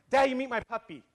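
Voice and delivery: high-pitched mock little-girl voice; imitating littlest daughter. Littlest girl to her dad in the car, imitated high child voice. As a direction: play a small child proudly presenting to Dad. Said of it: "my littlest girl, Nevaeh, goes".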